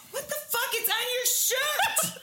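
high, loud, half-laughing shout, slowing at the end; mock shocked yell. Roommates confronting the mayo culprit, imitated with a laughing yell. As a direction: squeal it like a roommate who just spotted mayo on your shirt.

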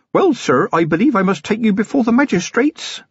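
high-pitched youth with a questioning lift; tentative, deferential and high; unsure young constable. A narrator's reading. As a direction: state it as if asking permission. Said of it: "noticed the little question mark".

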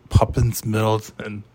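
normal talking voice

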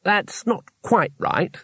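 slow, high, tactful correction; gingerly spaced-out words; carefully. A narrator's reading. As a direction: pick each word with care. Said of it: "said Two Flower carefully".

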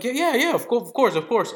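quick to say yes, pitch jumping up; eager agreement. Retold or imitated. Acting out agreeing to buy food.

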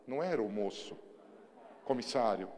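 usual speaking voice

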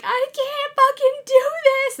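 very high, slow, strained wail; despairing wail. Retold or imitated. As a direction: wail it like you're at the end of your rope. Despair during pushing, quoted.

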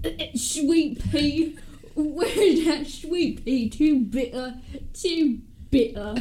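slow, floundering Cockney; stupid Cockney accent. Acted. Sally fumbling among jars, overcome by fumes, Cockney accent. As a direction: drag it out in hammy Cockney. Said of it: "let's do the stupid Cockney one".

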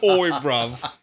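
mock Cockney accent greeting; put-on London Cockney accent; hammy Cockney lad accent. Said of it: "turning Cockney".

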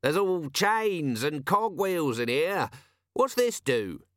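drawn-out call from within a metal hull; metallic voice from inside. A narrator's reading. Corporal calls from the belly of the fish, metallic. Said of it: "came a metallic voice".

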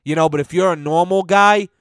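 usual speaking voice

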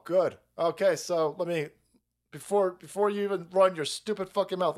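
ordinary talk, nothing marked

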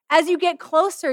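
normal talking voice

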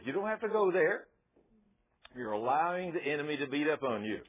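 usual speaking voice

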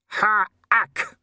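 high, loud dwarf exclamation; shouting. A narrator's reading. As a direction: shout it out sharply. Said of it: "he shouted".

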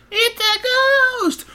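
very high, drawn-out scream; mock terrified shriek. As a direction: shriek it in hammed-up fright. House reacts to knocking ghost.

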